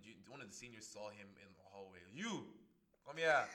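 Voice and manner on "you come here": loud commanding call. Retold or imitated. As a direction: bark the order. Senior calling the junior.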